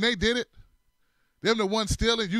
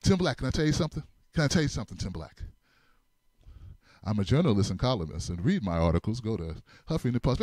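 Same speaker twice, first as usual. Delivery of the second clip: droning self-promoter; 8 semitones lower, faster than usual. Retold or imitated. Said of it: "he'll be like".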